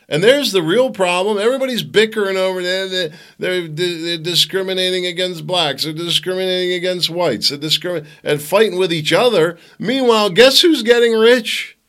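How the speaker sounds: high, loud, exasperated tirade; worked-up rant. Real, not acted. Host rants about everybody bickering while others get rich, worked-up.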